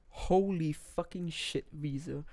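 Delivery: slow, hushed amazement; awestruck. Real, not acted. Reviewer reacting to the album's closing song, awestruck. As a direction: draw it out softly in disbelief.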